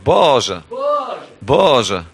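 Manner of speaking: loud desperate cry of Boże; crying out to God. Pretend. Preacher voicing the congregation, a loud cry to God. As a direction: call out Boże from the bottom of the well. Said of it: "z tego miejsca krzyczycie".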